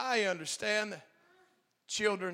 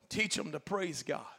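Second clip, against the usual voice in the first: ordinary talk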